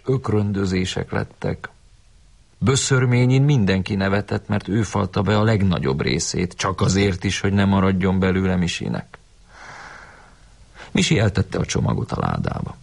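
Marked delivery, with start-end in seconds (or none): none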